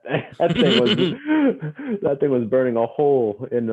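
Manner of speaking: high, loud, breaking into laughter; laughing. Real, not acted. Guest tells host about the hidden engagement ring, laughing. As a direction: laugh mid-phrase and push on through it.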